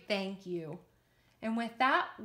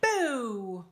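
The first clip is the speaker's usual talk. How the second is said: shouted boo; 5 dB louder than usual. Shown as a demonstration. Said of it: "Let me hear you shout out".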